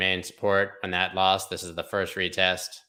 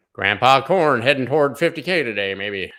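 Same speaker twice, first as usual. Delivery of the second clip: higher, louder mock country twang; country accent; 4 semitones higher, 6 dB louder than usual. Joking to viewers about Grandpa Corn, country accent. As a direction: put on a loud country accent. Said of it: "said that in a country accent".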